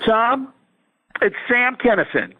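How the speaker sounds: high-pitched Sam Kinison impression. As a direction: pitch the voice way up as a famous comic. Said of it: "Sam Kinison had been dead".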